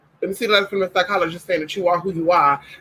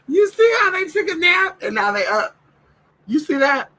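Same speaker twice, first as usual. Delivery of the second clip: high pet-talk voice; 12 semitones higher than usual. Real, not acted. Teasing the dogs after nap.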